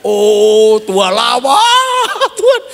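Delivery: slow, drawn-out and loud prayer cry. Retold or imitated. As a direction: cry out to God, stretching the words. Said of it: "Itu doa". (doa berteriak yang dipanjangkan dan lebih keras)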